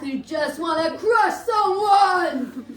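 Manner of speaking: building from talk into a loud, drawn-out roar. Shown as a demonstration. Hockey fan confessing how they watch games, building roar. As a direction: roar it out slowly, swelling to the end. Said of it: "I roar like a big".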